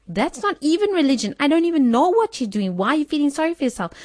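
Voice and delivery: exasperated. Retold or imitated. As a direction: scold them out of their pity party. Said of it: "and I'm like".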